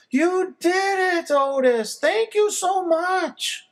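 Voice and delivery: very high, slow gushing thanks; overjoyed mother's voice; delighted mom. A narrator's reading. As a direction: mom thanking her son for the puppy. Mom thrilled puppy returned.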